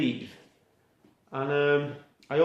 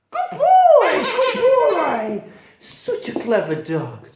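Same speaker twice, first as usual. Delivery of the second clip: squealing praise; 5 dB louder, much slower than usual. Real, not acted. Owner rewarding her puppy with a high, excited squeal. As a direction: squeal with delight at the dog.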